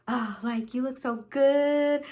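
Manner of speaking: slow, drawn-out admiring coo; mock drunk swooning. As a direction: play tipsy and smitten, stretching the words. Drunk swooning over her boyfriend.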